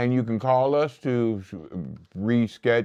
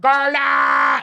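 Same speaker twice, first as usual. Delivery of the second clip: calling out; 7 dB louder than usual. Real, not acted. Shout-out to family on live show.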